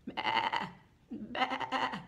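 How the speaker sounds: short wobbly bleats; sheep bleating. Acted. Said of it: "What does a sheep say?".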